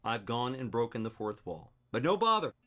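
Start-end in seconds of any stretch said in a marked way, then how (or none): 1.9-2.5 loud, higher theatrical delivery; declaiming. Acted. Actor defying the plain-read note, loud declaiming. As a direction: swell into a big stage speech. Actor building the scripted speech.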